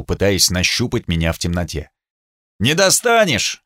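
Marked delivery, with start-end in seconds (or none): 2.6-3.6 shouting. A narrator's reading. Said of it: "крикнул я".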